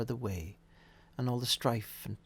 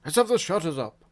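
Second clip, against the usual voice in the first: rich, jovial voice calling out loud and higher; Fezziwig; 4 semitones higher, 9 dB louder, faster than usual. A narrator's reading. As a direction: call out with fat, comfortable joviality. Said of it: "called out in a comfortable, oily, rich, fat, jovial voice".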